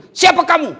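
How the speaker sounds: husband startled by the creature at the well; startled cry. Retold or imitated. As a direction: jump back and yell the question. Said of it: "Kaget suaminya".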